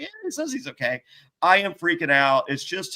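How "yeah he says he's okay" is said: high, soft, quick put-on voice; lightly voiced staff member; mock staff voice. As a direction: imitate doubtful onlookers.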